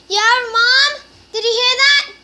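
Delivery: loud high call to another room; yelling across the house to mom. Real, not acted. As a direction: shout to make mom hear. Calling out to mom elsewhere.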